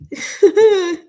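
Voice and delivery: loud, high nervous laugh; a bright, loud laugh bursting out; high burst of laughter. Real, not acted.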